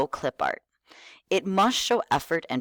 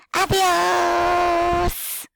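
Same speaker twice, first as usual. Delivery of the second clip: put-on kid voice; 5 dB louder than usual. Shown as a demonstration. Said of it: "talk very enthusiastically with a kid voice".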